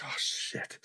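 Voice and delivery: hushed, voiceless curse; retold dismay. Jumper behind a fallen diver, whispered dismay. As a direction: say it under the breath, imitating dismay.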